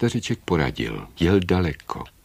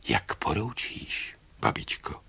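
submit quietly, almost under the breath; hushed obedience; 2 semitones lower, 7 dB quieter than usual. A narrator's reading. Bird Mogul obeys Baba Yaga's order, hushed.

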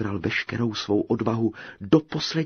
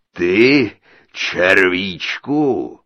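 booming giant; 12 dB louder, much slower than usual. A narrator's reading. The giant greeting his captive bride, booming. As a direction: boom it out like a huge ogre.

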